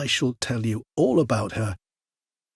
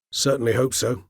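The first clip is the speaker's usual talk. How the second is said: ordinary talk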